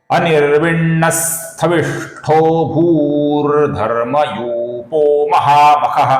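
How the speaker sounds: measured chant of a Sanskrit verse; shloka recitation. Shown as a demonstration. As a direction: recite each word apart like a chant. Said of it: "फिर से एक बार देखिए".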